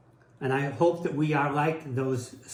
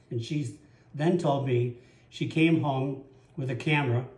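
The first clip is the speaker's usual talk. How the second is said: usual speaking voice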